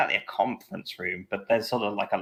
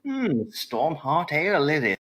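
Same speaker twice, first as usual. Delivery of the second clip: lightly voiced friendly barmaid, a bit higher; 8 semitones higher, 3 dB louder than usual. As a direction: play the tavern barmaid, welcoming.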